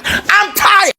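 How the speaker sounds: high-pitched, worn-out wail; exasperated cry. Retold or imitated. Teen with two jobs answers sister, exasperated cry.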